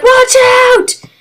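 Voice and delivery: sudden high yell of warning; warning shout. Retold or imitated. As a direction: shout it to the crew as rocks fall. Astronauts hit by asteroid.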